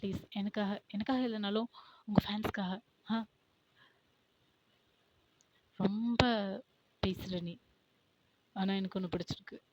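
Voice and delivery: usual speaking voice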